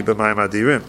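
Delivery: "B'mayim Adirim" run together as one phrase; no pause. Shown as a demonstration. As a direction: link "B'mayim" straight into "Adirim". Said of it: "without a pause".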